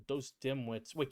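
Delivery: normal talking voice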